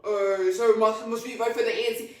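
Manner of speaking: loud mocking imitation; mocking critics. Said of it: "a lot of people were like".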